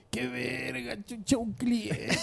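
soft, slow alarmed hush; hushed panic. Retold or imitated. Seller spotting a client while in costume, hushed and slow. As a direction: mutter it like you've been spotted. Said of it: "así este".

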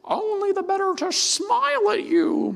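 very high, falsetto granny voice; a wolf squeaking sweetly as grandmother; wolf disguised as grandma. Acted. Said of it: "And the wolf says".

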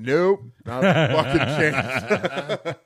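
slow, laughing through the words; laughing refusal. Retold or imitated. Wrestler telling the promoter no while laughing slowly. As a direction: chuckle out a flat no. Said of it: "I went".

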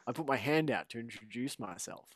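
ordinary talk

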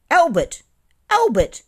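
high, loud scolding call; sharp reproof. A narrator's reading. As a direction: call the name out in loud rebuke. Bill scolds the rude pudding.

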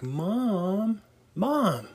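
mildly whiny Mom call. Retold or imitated. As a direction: whine Mom at your mother, a touch high. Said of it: "my whiny mom voice".

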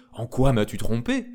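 loud, indignant demand; angry accusation. Acted. Tsarina turns on her servant, angrily accusing. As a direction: confront the one who deceived you, loudly.

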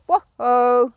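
long, loud whoa; drawn-out whoa. Real, not acted. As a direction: call the horse to halt. Voice command to stop horse.

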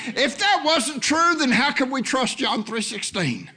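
high, emphatic preacher's delivery; fervent preaching. Real, not acted. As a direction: drive the point home to the congregation. Preaches at full force.

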